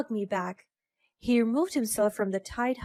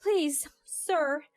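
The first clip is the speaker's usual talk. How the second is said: begging; 6 semitones higher than usual. Acted. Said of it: "begged for forgiveness".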